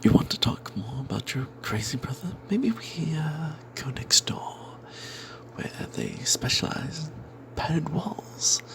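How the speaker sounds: usual speaking voice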